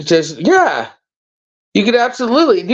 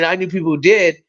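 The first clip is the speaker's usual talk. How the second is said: normal talking voice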